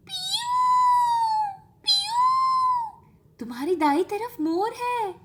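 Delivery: high chirpy peacock call then eager pointing out. A narrator's reading. Guide chirping excitedly about a peacock. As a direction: chirp it out brightly. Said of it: "Ranveer uncle ने चहकते हुए कहा".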